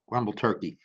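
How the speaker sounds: plain reading voice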